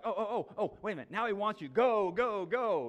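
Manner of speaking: eager crowd. Acted. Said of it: "they're like".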